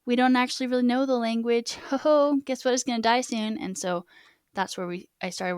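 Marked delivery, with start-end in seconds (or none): 0.1-3.6 loud, sarcastic game-show surprise; mock cheerful reveal. To viewers, sarcastically announcing the language is dying, mock cheerful. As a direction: sell the bad news like a cheery prize. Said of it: "it was like".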